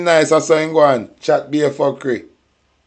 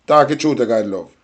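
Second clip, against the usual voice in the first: ordinary talk, nothing marked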